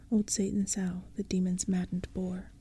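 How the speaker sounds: plain reading voice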